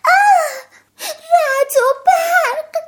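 very high, loud, slow shriek; terrified scream. Acted. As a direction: scream in panic at the thunder. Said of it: "فرانکلین باز جیغ کشید".

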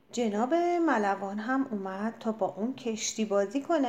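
usual speaking voice

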